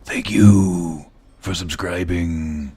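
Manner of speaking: low, slow, close-mic growl; gruff soldier whisper into the mic; Solid Snake voice. Shown as a demonstration. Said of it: "lean in real close to the mic and do a Solid Snake voice".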